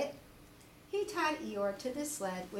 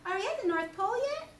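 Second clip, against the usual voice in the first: high, fast little Roo voice; an eager small child asking quickly; 10 semitones higher, 4 dB louder, much faster than usual. Acted. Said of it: "asked Roo".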